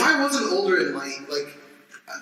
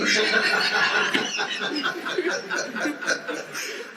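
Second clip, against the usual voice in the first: long laugh; 11 semitones higher than usual. Real, not acted. Laughing at his own story.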